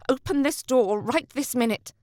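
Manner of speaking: angry yell through gritted teeth; demanding, teeth clenched. A narrator's reading. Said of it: "she yelled through gritted teeth".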